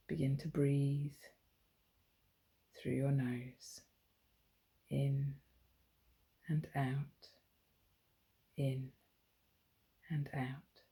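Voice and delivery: slow, soft bedtime meditation with long pauses; guided sleep meditation. Shown as a demonstration. Mother guiding her daughter through a bedtime body scan, slow soothing meditation. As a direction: soothe a child towards sleep, letting phrase ends fade away.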